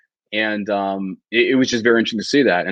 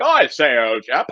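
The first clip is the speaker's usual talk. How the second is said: British officer impression; 4 semitones higher, slower than usual. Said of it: "the freaking revolutionary British Army".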